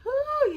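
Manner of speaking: big relieved exhale; loud whew. Real, not acted. Tired sigh of relief.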